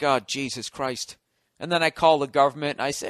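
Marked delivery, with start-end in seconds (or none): 0.0-1.2 mock weary sigh. Mock exasperation.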